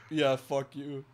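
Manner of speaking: usual speaking voice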